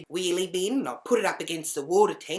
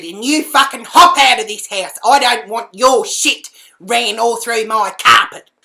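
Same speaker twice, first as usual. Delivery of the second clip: furious shouting; 7 semitones higher, 16 dB louder than usual. Retold or imitated. Shouting over the screaming housemate to evict him, furious. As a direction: yell it, pounding the last words.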